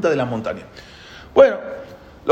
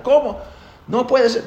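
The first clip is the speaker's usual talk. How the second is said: eagle disbelief; 10 semitones higher than usual. Acted.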